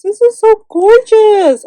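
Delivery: high, loud, drawn-out squeal of admiration; gushing delight. Real, not acted. Vlogger showing off her swap partner's gift, gushing delight. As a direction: exclaim it slowly at a real high, loud pitch.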